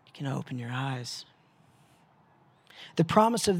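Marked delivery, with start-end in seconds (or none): 0.1-1.2 soft meditation close. Acted. Ends the meditation.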